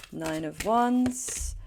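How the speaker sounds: normal talking voice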